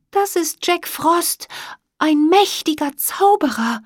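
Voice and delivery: a small fairy, trembling at the name of the wizard; awed fairy Emma. A narrator's reading. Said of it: "Auch sie konnte ein Zittern nicht unterdrücken".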